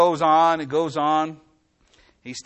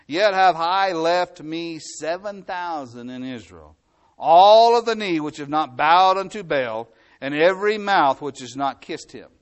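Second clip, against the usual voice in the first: emphatic scripture reading; 5 dB louder than usual. Acted. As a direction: drive each phrase home, swelling loud in the middle. Reads scripture with force.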